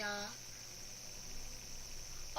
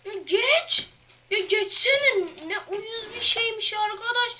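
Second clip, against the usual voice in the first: higher, louder annoyed complaining; exasperated; 7 semitones higher, 7 dB louder than usual. Real, not acted. Gamer venting anger at a stuck obstacle. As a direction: vent at the game that won't let you pass.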